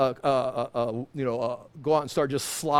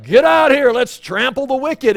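louder, higher battle rallying; rallying warrior call; 7 semitones higher, 10 dB louder than usual. Pretend. Pastor voicing a comrade calling him into battle, loud. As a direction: rouse the troops to charge. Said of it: "And he's like".

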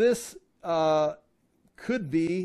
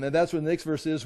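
normal talking voice

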